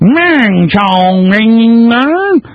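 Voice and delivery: high, long-drawn Kentucky gibberish twang; mock Hillary Clinton Kentucky accent. Host mocking her crowd speeches, exaggerated Kentucky accent. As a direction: stretch out a hammed-up Kentucky drawl. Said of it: "local dialect in Kentucky".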